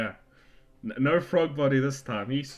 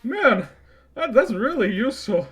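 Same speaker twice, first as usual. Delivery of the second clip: Yardratian impression; 10 semitones higher, 7 dB louder than usual. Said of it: "was like".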